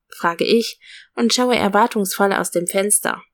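ordinary delivery, nothing marked

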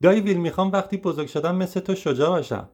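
high, fast eager call; child's eager shout. Retold or imitated. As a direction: call out to uncle with all her might. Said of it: "با تمام توانش فریاد زد".

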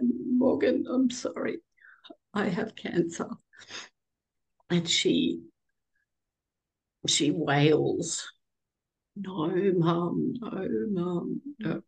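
high, tearful voice near a whisper; choking up while telling her daughter; emotional, voice breaking. Real, not acted. Voice breaking as she tells her daughter the news. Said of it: "sorry, I get really emotional".

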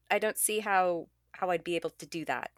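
ordinary talk

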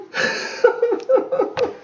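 helpless high laughter; high burst of laughter. Real, not acted. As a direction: let out a shrieking laugh. Bursts out laughing after punchline.

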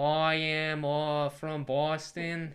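hammed-up, slow Boston accent; bad Boston accent impression; JFK. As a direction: imitate a Boston politician, loud and drawn out. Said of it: "What does J F K have?".

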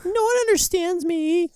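high, loud cartoon whine; SpongeBob quote. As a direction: squeal it like a cartoon character. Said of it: "It's a SpongeBob reference".

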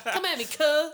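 loud, high mock challenge; playful shouted taunt; voicing a rooster. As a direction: dare them as a puffed-up rooster. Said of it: "He's like".

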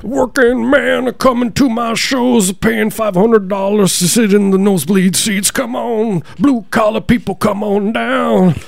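high, drawn-out rock-star impression. Comic mocking a rich rocker courting fans, high drawling impression.

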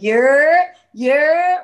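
loud, rising, stretched-out holler; imitated group greeting call, loud and high; drawn-out call. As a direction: call it out long with the pitch climbing. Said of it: "we be like".

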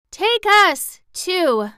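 high, loud piglet plea; crying out. A narrator's reading. Piglets begging the girl to take them, crying out. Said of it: "cried the nine tiny piglets, all in one breath".

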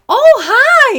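loud, high, bright greeting shout; cheerful yell. Retold or imitated. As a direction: yell a startling hello. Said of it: "I just yell in her face".

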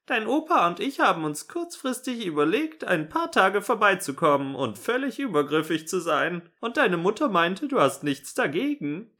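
high-pitched, merry granny voice; cheerful grandma. A narrator's reading. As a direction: chirp it as a breezy grandmother. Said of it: "sagte sie immer noch fröhlich".

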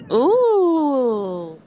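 startled high ooh; surprised. Real, not acted. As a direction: gasp at the thunder. Reacting to a thunderclap.

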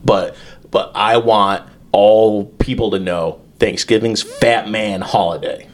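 ordinary talk, nothing marked

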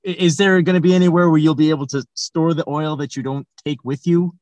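usual speaking voice

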